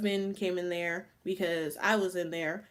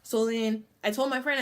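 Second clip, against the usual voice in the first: usual speaking voice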